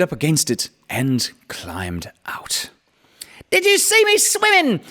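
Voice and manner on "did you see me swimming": very high, loud squeaky little joey with Australian twang; squeaky Roo. A narrator's reading. Roo squeaks proudly to everyone from the pool, very high. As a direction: squeak it out like an excited little kangaroo. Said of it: "squeaked Roo excitedly".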